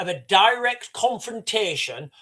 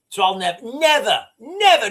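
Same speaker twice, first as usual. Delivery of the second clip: each never louder and higher; emphatic never; 4 semitones higher, 6 dB louder than usual. Real, not acted. Vowing to the chat, never, never, rising emphasis.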